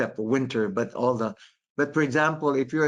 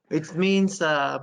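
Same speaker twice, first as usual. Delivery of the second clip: ordinary talk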